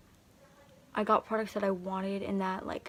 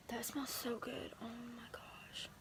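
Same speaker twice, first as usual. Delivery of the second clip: savoring a smell; 13 dB quieter than usual. Real, not acted. Sniffing blush, loves the scent.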